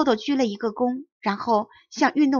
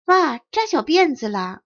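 put-on louder and higher, kids crying out in delight; excited shouting; 5 semitones higher, 5 dB louder than usual. A narrator's reading. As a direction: call it out together like excited classmates. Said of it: "一起嚷了起来". (兴奋地大声嚷)

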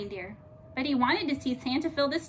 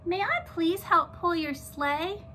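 slow, polite moose; gentle moose voice; 5 semitones higher than usual. A narrator's reading. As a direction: ask Santa slowly and politely. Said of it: "Milton asked Santa".

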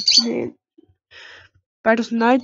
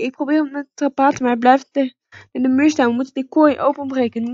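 ordinary talk, nothing marked

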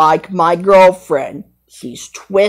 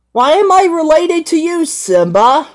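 higher, a pretend Nala; high girl lion voice; 11 semitones higher than usual. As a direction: squeak it out as the girlfriend lion. Nala questions Simba.

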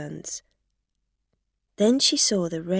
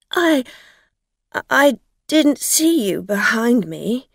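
flustered; 5 semitones higher, 9 dB louder than usual. Acted. Dorothy caught out.

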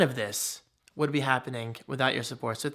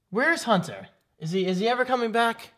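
dramatic; 7 semitones higher than usual. Acted. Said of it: "I'm just being dramatic".

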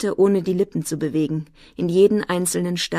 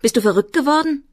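high-pitched incredulous outburst; raised, agitated question rising in pitch; shocked, exclaimed disbelief; called out; 8 semitones higher, 4 dB louder, faster than usual. A narrator's reading. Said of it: "rief Sigi".

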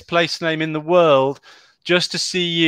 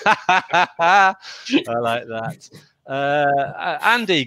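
normal talking voice

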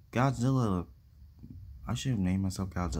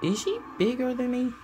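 high toy voice. Acted. Toy voice worried about size.

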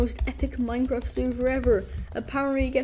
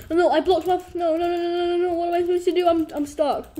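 high, louder frantic pleading; panicked; 5 semitones higher, 4 dB louder, faster than usual. Real, not acted. Gamer trapped in his own bed fort, high frantic panic. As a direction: spiral into panic.

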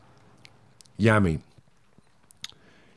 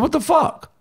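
sharp jump up in pitch; high, indignant re-enacted exclamation; retold irate outburst; 4 dB louder than usual. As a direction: throw it at him in disbelief. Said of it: "screaming at him when I'm talking".

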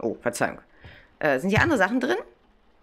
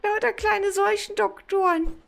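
cutesy squeal; slower than usual. Real, not acted. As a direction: coo over something adorable. Gushing over cute plague doctors. (quietschend hohe Verzückung)

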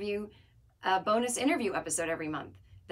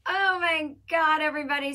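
hyped announcement; 9 semitones higher, 7 dB louder than usual. Real, not acted. Podcaster greeting listeners before tour dates, hyped announcement. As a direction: hail everyone at full energy, stretching it out.

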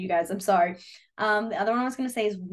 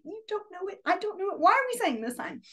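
exasperated; 8 semitones higher than usual. Retold or imitated. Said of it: "I'm like".

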